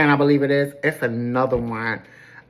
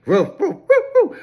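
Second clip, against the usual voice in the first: yapping dog barks, rising high at the end; childish mock woof woof; mock barking; 5 semitones higher, 3 dB louder than usual. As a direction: bark like a bratty little dog. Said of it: "start barking and acting like a little child".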